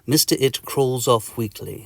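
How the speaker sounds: ordinary delivery, nothing marked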